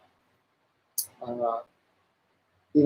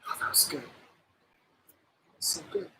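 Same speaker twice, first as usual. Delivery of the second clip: breathless whisper after the bite, overcome; hushed and moved, savoring it; emotional whisper; 17 dB quieter than usual. Real, not acted. After tasting her own sandwich on stream, an emotional whisper. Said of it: "I'm just getting emotional".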